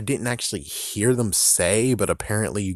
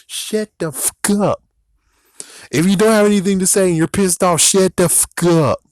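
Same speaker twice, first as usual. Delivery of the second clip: higher, louder, furious; angry yell; 8 semitones higher, 5 dB louder than usual. Real, not acted. Vlogger yelling at complaining fans, furious. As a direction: tell them off hard.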